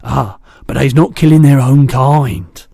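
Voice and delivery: a big kindly giant chatting; booming gentle giant; the BFG. A narrator's reading. BFG voiced.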